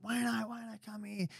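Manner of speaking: high, softened imitation of calling; retold calling out. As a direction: mimic mates calling out, high but held back. Mates calling him over, retold.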